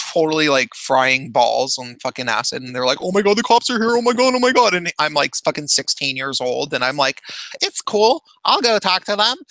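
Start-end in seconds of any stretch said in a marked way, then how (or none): 3.0-4.7 much higher, louder freaked-out cry; mock panic. Partygoers on acid panicking that the cops arrived, mock shrill. 7.6-9.4 breezy reassurance. Retold or imitated. Teen reassures panicked friends.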